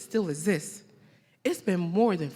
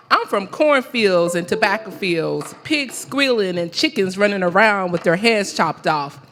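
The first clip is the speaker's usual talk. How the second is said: loud, emphatic live poetry recital with measured pauses; spoken-word poem; 3 semitones higher, 6 dB louder than usual. Acted. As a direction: declaim it line by line, letting each phrase land.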